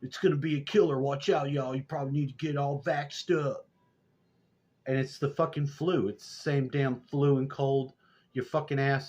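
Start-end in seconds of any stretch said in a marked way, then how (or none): 0.0-3.7 high, loud put-on health scare; mock the officials hyping a new variant; hammed-up public warning, pitched up; mock alarmist warning. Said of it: "They're gonna tell you all this shit".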